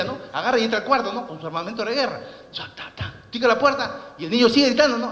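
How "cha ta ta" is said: whispered gunfire noise. Retold or imitated. Imitating gunfire.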